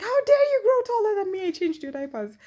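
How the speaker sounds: high, fast, loud playful outrage; mock indignant aunt. Aunt teasing her grown nephews, mock outrage. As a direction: scold them for growing up, teasingly. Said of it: "and go like".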